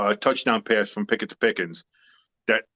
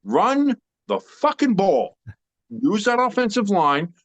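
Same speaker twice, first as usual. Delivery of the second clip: emphatic demand; 8 semitones higher, slower than usual. Real, not acted. Ranting football advice.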